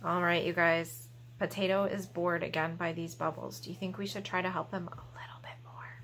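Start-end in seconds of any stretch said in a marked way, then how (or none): 1.4-5.9 put-on soft conspiratorial whisper to the kids. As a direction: lean in and whisper the question.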